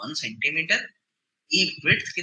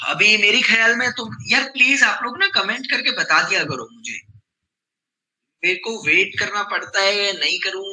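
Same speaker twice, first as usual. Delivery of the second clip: loud pleading; 4 semitones higher, 6 dB louder than usual. Real, not acted. Pleading loudly for comments.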